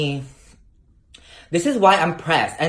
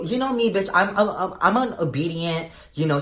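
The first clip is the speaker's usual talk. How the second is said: ordinary talk, nothing marked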